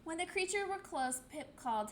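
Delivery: ordinary delivery, nothing marked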